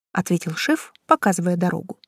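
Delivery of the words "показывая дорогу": ordinary delivery, nothing marked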